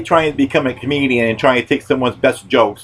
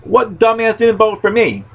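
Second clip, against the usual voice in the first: mock indignant; 11 semitones higher than usual. Demanding who didn't vote.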